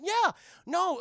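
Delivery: high, loud eager outburst; excited insistence. Retold or imitated. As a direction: burst in, imitating an excited kid. Webby insists it is true.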